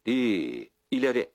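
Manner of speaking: loud rising call to the horses; shout to horses. A narrator's reading. Andreşko cracking the whip at the horses, loud call. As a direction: urge the horses on, voice climbing. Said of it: "kamçısını sallayıp bağırdı".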